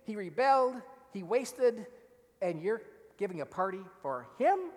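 elder son listing his virtues in outrage; indignant list. Retold or imitated. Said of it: "just see what he's saying".

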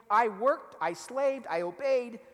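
elder son listing his virtues in outrage; indignant list. Retold or imitated. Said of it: "just see what he's saying".

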